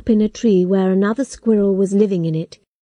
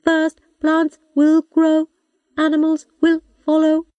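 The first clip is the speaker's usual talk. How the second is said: computer voice; 7 semitones higher than usual. A narrator's reading. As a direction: speak like a patient old robot. Robot computer speaks.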